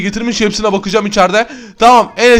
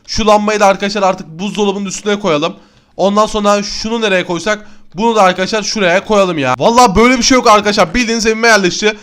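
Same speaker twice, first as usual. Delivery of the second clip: normal talking voice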